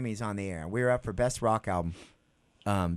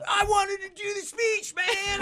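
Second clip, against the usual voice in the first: high, shouted mock complaint; whiny bandmate; slower than usual. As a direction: mimic a sulking singer yelling. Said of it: "He's like".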